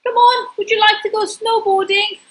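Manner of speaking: high, bright calling voice as Robbie. Acted. Robbie calling at Pingu's door, high and eager.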